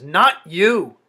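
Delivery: loud, cutting "Not you!"; sharp refusal. Acted. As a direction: throw it back at him, loud.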